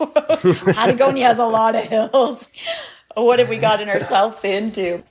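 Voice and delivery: loud, laughing through it. Real, not acted. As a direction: laugh at their own folly. Laughs while telling.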